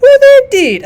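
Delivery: high, loud, imitated colonel's astonishment; mock surprise. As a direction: squeak it out like a startled colonel. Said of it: "he says".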